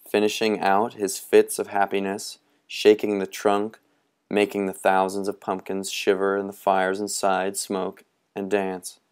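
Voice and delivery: ordinary delivery, nothing marked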